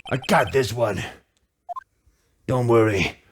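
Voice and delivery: deep, cocky and calm; low confident lawyer. Acted. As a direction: reassure with low swagger.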